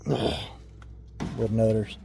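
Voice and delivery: slow, soft, sighing murmur; sighing repeat. Real, not acted. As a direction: sigh and drawl the name out softly. Repeats the name after a sigh.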